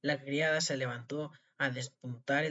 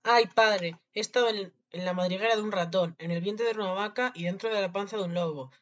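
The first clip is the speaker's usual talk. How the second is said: excited recounting; 6 semitones higher, 6 dB louder than usual. A narrator's reading. Freed boy lists his adventures.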